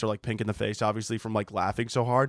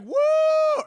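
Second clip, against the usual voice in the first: loud high whoop; crowd cheer; 5 dB louder than usual. Retold or imitated. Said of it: "everyone's like".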